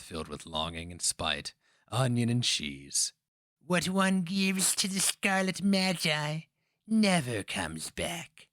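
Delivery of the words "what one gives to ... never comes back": put-on high and slow, an old witch's warning; slow, high crone intoning a threat; ominous witch voice. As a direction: draw it out like a dark prophecy. Witch pronounces a grim warning.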